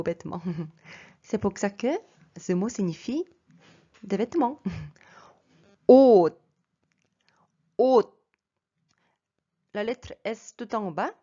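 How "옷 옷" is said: 옷 said loudly with the final letter as a stopped T; loud isolated model of 옷. Shown as a demonstration. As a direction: end 옷 on a T, not an S. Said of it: "ça se prononce T".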